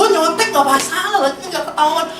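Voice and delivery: high-pitched peer-pressure pleading; Jakarta schoolmate. Acted. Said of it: "saya pakai dialog Jakarta".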